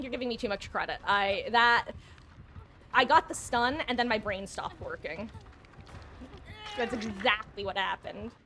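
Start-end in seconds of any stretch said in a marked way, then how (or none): none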